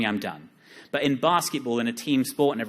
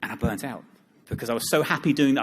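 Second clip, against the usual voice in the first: usual speaking voice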